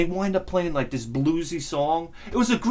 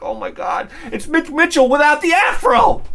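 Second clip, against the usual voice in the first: shouting in amazement, higher and louder; excited shout; 9 semitones higher, 7 dB louder than usual. Retold or imitated. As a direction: blurt it out like you can't believe your eyes. Excited viewer exclaiming.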